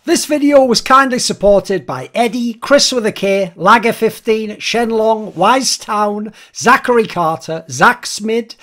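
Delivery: brisk, upbeat thank-you list read; announcer-style patron credits; patron shoutout read. Acted. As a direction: rattle off the supporters' names cheerfully.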